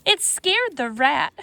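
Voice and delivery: ordinary delivery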